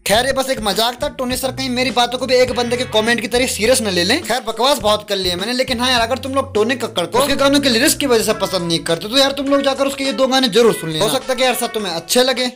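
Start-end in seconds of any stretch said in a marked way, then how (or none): none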